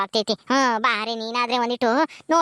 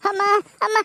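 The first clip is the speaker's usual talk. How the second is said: higher, crying out in labour pain; labour pain cries; 10 semitones higher than usual. Acted. Woman in labour crying to the midwife, pained wail. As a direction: wail through the contraction.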